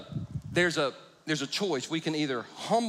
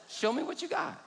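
playful dare; 13 semitones higher than usual. Retold or imitated. Challenging the seven-year-old.

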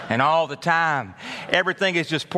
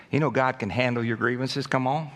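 ordinary delivery

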